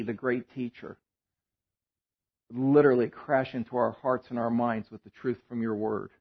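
low, soft, hushed prayer voice. Acted. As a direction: pray quietly over the room. Leads the congregation in prayer.

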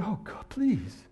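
slow, desperate plea to God; pleading prayer. Retold or imitated. Recalling his panicked 2 a.m. plea to God, slow and begging. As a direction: drag out a begging prayer, half-sobbing in tone.

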